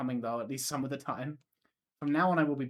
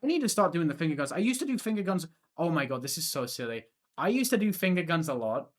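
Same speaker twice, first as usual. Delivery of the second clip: excited; 4 semitones higher, 5 dB louder than usual. Real, not acted. Excited rambling about finger guns.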